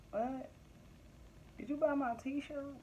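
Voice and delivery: softly pointed, feigned puzzlement; cool mock questioning. Business owner acting out facing unsupportive friends, quietly questioning. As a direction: quietly challenge them, acting it out. Acted cool retort to non-supporters.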